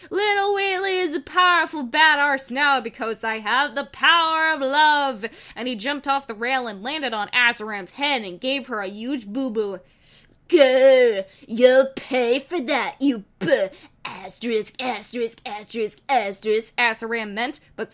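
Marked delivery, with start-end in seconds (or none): 0.1-5.3 higher, louder proud cry; triumphant boast. Acted. 10.5-16.7 loud growling threat; mock fury; enraged villain growl. Villain enraged after hit.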